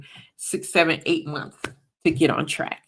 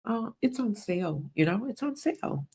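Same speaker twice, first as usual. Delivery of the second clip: softer and higher, coaxing mock whisper; tempting whisper; 5 semitones higher, 6 dB quieter than usual. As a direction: lightly play the serpent coaxing a buyer. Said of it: "but he'll just whisper to you".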